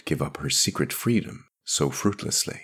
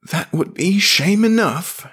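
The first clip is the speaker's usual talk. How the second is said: high, louder priest's voice; fervent priest; 13 semitones higher, 6 dB louder than usual. Acted. Priest answering her plea, fervent. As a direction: concede it high and intense.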